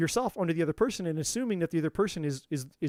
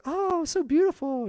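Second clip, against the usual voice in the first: much higher, louder, slow gushing; mock swoon; 11 semitones higher, 5 dB louder, slower than usual. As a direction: gush over her like a swooning admirer. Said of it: "you're like".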